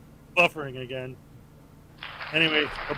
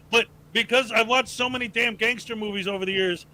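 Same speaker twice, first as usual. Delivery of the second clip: usual speaking voice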